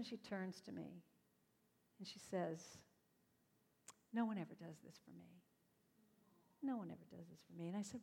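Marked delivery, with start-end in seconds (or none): 4.1-7.7 much softer, lower confession; touched and quiet. Retold or imitated. Woman quietly admitting nobody does this for her, soft. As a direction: let it come out hushed and moved.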